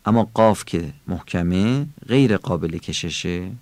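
ordinary talk, nothing marked

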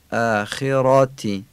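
اخراتی slowly, with a full dark "rɑ"; heavy R and fatha. Shown as a demonstration. Teacher showing learners the R of اخراتی, heavy R. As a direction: draw out اخراتی, thickening the R and its vowel.

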